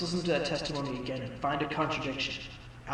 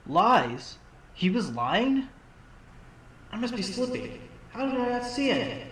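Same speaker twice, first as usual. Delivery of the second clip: higher and startled, then chiding himself; surprised; 6 semitones higher, 3 dB louder than usual. Acted. Attorney realizes the witness lied, startled. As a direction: caught off guard and kicking himself. Defense attorney shocked by lies.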